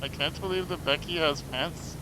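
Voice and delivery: normal talking voice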